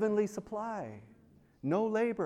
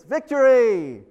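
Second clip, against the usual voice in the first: triumphant cry; 7 semitones higher, 10 dB louder, slower than usual. Retold or imitated. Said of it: "they sing the song of Moses".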